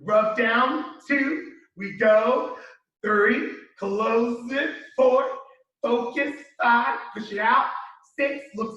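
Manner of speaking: slow, spelled-out steps; slow walkthrough. Shown as a demonstration. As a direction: talk it through slowly. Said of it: "let's try that again".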